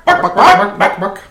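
loud high clucking; chicken clucking. Retold or imitated. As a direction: cluck like a hen.

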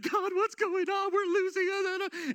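high, whiny mock lament from Joshua; crying Joshua. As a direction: play Joshua wailing to God, slow on the last word. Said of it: "Joshua is crying at this moment".

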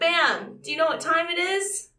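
high, punchy show opener; hyped intro. Pretend. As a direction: kick off the segment with energy.

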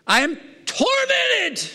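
high, drawn-out cry of torment; tormented rich man. Acted. Preacher voicing the rich man in the flame, tormented wail. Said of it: "You know what the rich man says now?".

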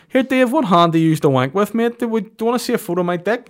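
squeaky creepy fan impression; mock creepy listener asking sex questions; weird listener.

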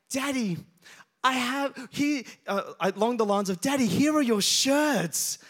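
a hint of an eager daughter handing over the gift; Lydia, excited. Daughter hands dad his present, lightly voiced excited child.

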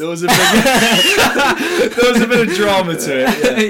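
high, loud laughing talk. Real, not acted. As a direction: laugh through the words. Laughing at the memory.

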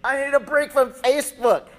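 high, loud mock yell; exasperated outburst. Pastor fed up with Facebook, mock exasperated yell. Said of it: "I say".

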